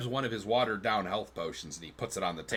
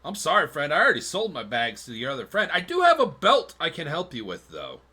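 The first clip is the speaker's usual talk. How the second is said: apologetic tanner; 3 semitones higher, 7 dB louder than usual. Acted. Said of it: "kinda looks at you and goes".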